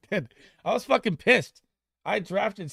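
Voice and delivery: high, loud exasperated outburst; loud venting. Real, not acted. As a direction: blurt out the frustration at full volume. Venting about drafted player.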